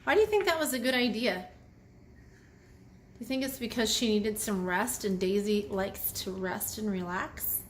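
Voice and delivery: ordinary delivery, nothing marked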